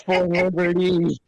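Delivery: high, soaring patriotic cry; heroic cry. Retold or imitated. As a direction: call it out like a hero. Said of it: "I'll just say".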